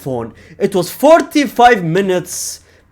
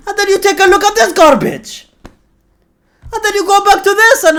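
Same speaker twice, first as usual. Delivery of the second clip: high, louder mocking whine; mock outrage; 5 dB louder than usual. Real, not acted. Fan mocking Madrid lineup, high whiny outrage. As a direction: squeal in mock disgust.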